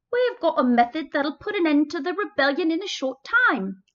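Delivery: put-on British accent. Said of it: "That's my accent".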